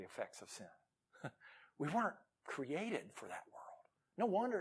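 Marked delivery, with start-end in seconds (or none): 1.8-3.8 soft whisper. Acted. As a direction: breathe it quietly to them. Hushed point after laugh.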